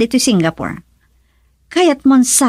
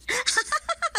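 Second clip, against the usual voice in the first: shrill mocking giggle at him; high mocking laugh; 12 dB quieter than usual. Wife laughing at husband's money trouble, high mocking laugh.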